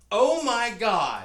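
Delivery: slow, loud, high "Oh, my God"; loud exasperated groan. Retold or imitated. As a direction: drag it out loud in despair. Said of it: "out loud".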